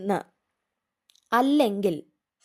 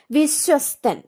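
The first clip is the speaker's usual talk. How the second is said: വിശ്വസ്തൻ called out higher and louder; announced word; 4 semitones higher, 5 dB louder, slower than usual. Shown as a demonstration. Teacher announcing the word to students, raised and loud. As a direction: call out വിശ്വസ്തൻ loudly. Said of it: "സായും തായും കൂടെയാണ്".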